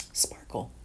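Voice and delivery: breathy whisper of sparkle, unvoiced. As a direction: whisper "sparkle" like a little secret. Playful whisper of one word.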